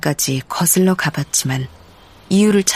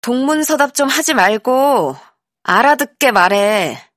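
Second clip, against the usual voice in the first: exasperated, higher voice, restrained anger; raised voice; 5 semitones higher than usual. A narrator's reading. Younger sibling demanding a clear answer, raised voice. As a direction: raise your voice, drag out the last words. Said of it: "꾹 누르며 목소리를 높였다".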